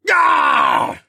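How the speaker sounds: loud, high wounded roar; roar of pain. A narrator's reading. Dino man stabbed in the eye, roaring in pain. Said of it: "he roared".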